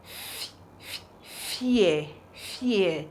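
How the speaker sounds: unvoiced "f-, f-" then blended "fear"; sounding out. Shown as a demonstration. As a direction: isolate the "f-" softly, then say "fear". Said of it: "Can you see how it's going?".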